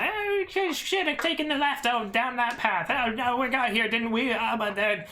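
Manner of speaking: high, fast squeaky kobold chatter. Acted. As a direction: babble like nervous little kobolds. Kobolds chattering to each other.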